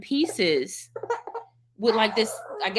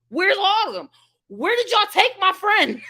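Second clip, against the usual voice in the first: high, louder frantic calling for a lost friend; panicked call; 9 semitones higher, 6 dB louder than usual. Retold or imitated. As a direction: shout for her like she vanished.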